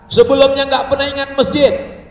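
loud, high thundering preacher; menggelegar. Acted. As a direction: boom it out sternly. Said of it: "Sampai di sini jadi menggelegar". (suara keras menggelegar)

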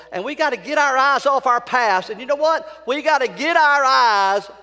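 loud, high preacher's exhortation; full-force preaching. Real, not acted. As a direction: drive it home to the congregation.

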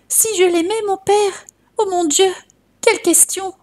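high, distraught outburst; desperate mother's protest, pitched high; frantic mother. A narrator's reading. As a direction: cry it out like a mother half mad with grief. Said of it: "comme une insensée".

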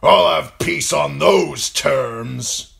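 emphatic northern lord; resolute lord. A narrator's reading. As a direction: state your terms forcefully, drawing out the words. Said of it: "Lord Karstark said".

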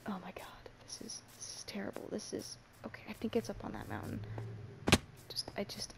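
soft frightened whisper to yourself; scared whisper. Real, not acted. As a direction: hush your fear while lost at night. Lost, scared, talking under breath.

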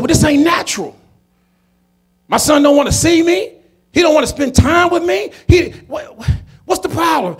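high-pitched, incredulous questioning; indignant protest. Retold or imitated. Father objects to the mother about his son, indignant.

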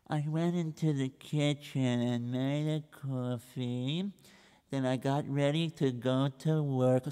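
soft, slow, dragging account; slow plodding recital. Acted. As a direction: drag out every boring detail. Reb Israel plods again.

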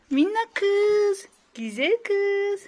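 slow, high doting coo; tender coo. Real, not acted. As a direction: stretch it out lovingly. Cooing to baby.

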